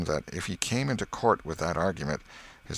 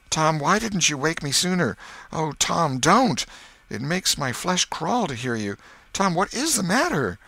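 put-on high, loud, pleading boy; urgent, horrified pleading; distressed; 10 semitones higher, 4 dB louder than usual. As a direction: beg him to stop, in alarm. Sid distressed at the groans.

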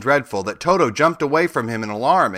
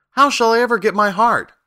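high, louder plaintive question; dismayed lament; 11 semitones higher, 5 dB louder than usual. A narrator's reading. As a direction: plead in dismay, pitched high. Tin Woodman laments his lost hope.

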